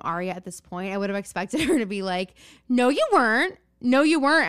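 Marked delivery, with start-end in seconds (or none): 2.7-4.5 loud, higher mock accusation; hammed-up loud denial; emphatic contradiction. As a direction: call out the lie, acting it. Imagined Aria contradicting Emily.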